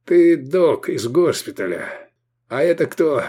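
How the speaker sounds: strained, croaking voice; hoarse croak. A narrator's reading. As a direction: wheeze it out with effort. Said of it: "прохрипел Мирарзал".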